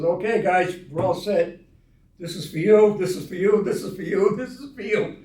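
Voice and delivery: a cheerful dealer passing out cards; cheery handing out. Retold or imitated. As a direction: examiner gleefully handing out licenses, loud. The examiner hands out the licenses.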